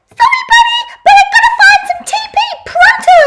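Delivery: very high, fast, shouted cartoon voice; frantic race car toy. A narrator's reading. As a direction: zip through it like a speeding toy car.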